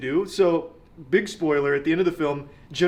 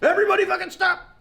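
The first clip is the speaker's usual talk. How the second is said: a much higher hammed-up command yell; mock shout; 14 semitones higher than usual. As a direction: bark the order in parody. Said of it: "she's like".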